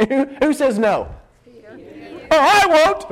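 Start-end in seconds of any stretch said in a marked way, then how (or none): 1.5-3.0 overeager denial. Acted. Peter swears he won't betray, blurting overeagerly.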